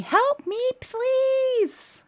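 put-on high squealed toddler plea; mock toddler scream. Mom imitating her toddler's high pleading scream. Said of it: "she kept screaming".

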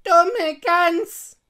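high, very loud scolding witch; old witch. A narrator's reading. Witch exasperated with Gretel, scolding. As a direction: snap the insult loudly in a high witch voice. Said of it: "sagte die Alte".

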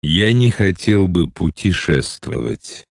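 ordinary talk, nothing marked

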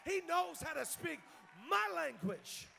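loud, high, shouted preacher's crescendo; full-force preaching. Real, not acted. Preacher rallying a church to give thanks, shouted preaching. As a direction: drive each line home to the congregation at full volume.

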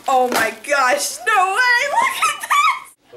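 high, loud, drawn-out squeals; thrilled amazement. Real, not acted. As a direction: squeal with laughing delight. Squealing over each new gift.

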